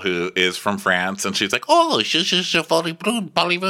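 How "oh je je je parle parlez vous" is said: high, stammering French; mock flustered French. French girl attempting French with Parisians, high flustered mock. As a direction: fumble for the French words in a high voice.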